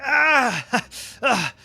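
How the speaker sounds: high, loud yelp, half laughing; a sudden alarmed "ah" that breaks into a laugh; startled cry. Acted. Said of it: "Yenny's like".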